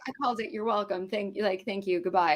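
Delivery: quiet gloating at dad; smug mic drop. Retold or imitated. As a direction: say it like you're walking away victorious. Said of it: "And I was like".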